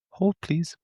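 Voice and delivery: singsong hold please; mock operator. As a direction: echo the call center hold line. Echoing the operator mimicry.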